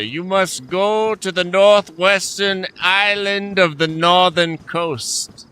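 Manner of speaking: high, slow, solemn guide voice; mock grand directions. To a stranger asking for scuba gear, lofty and slow. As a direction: pronounce it like an ancient oracle. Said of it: "I'm going to say".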